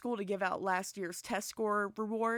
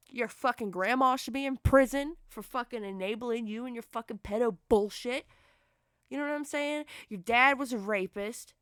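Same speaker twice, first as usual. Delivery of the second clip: real fury, much louder, spitting each insult; furious shouting; 2 semitones higher, 7 dB louder than usual. Real, not acted. Streamer shouting down an online troll, furious. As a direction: yell it straight at him, disgusted.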